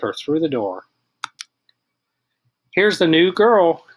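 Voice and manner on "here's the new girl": put-on loud, slow "Here's the new girl"; boy presenting the new girl to class; loud announcement. A narrator's reading. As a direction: announce her proudly to the whole class. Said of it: "he announced so loudly".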